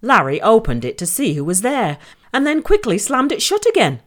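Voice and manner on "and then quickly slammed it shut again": usual speaking voice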